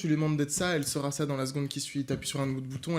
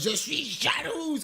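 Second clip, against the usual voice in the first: imitating cartoon voice; 10 semitones higher, slower than usual. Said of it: "qu'elle fasse".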